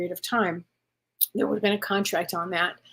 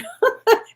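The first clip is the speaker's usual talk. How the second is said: much higher, louder real laugh; burst of laughter; 13 semitones higher, 6 dB louder than usual. Real, not acted. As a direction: cracks up at the card. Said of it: "which is the funniest thing".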